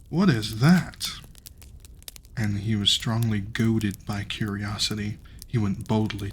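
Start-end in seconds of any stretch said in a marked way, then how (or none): 0.1-1.1 lightly voiced tailor, louder amazed "What is that"; tailor's voice. Reader retakes the tailor's cry in a voice, louder and amazed. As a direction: retake the line in character, marvelling. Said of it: "I should do voices for these people".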